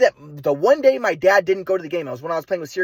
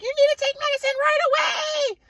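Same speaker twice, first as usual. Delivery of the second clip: shrieky mock-mom falsetto; high hammed-up maternal panic; imitating a hysterical mother. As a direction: insist frantically as a panicking mom, pitched very high. Imitating hysterical mother insisting on medicine.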